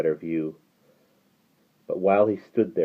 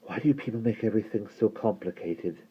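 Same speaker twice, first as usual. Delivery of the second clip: soft, higher-voiced exasperation; groaning complaint; 4 semitones higher, 7 dB quieter than usual. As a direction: groan it out quietly. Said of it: "Will groaned and took a deep breath".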